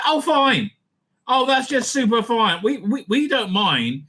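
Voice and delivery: mock nonchalance. Commentator mimics people excusing kiddie porn, mock nonchalance. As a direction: imitate people breezily waving it away. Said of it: "They're all like".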